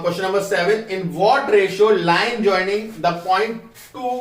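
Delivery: very slow, spaced-out reading of the question; slow dictation read. Acted. Teacher reading out the question slowly to the class. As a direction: read it word by word for students to copy.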